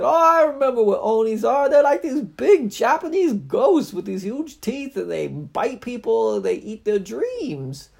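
very high, loud eager voice; high squeaky sidekick. Acted. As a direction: chirp it out like a cartoon pal. Stoogie voiced.

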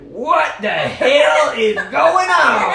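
slow, high, very loud yell; comic exasperated holler; bewildered shout. Acted. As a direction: shout in exaggerated disbelief, stretching each word. Stoogie reacts to nonsense story.

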